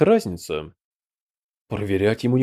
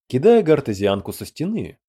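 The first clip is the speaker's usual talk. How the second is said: ordinary delivery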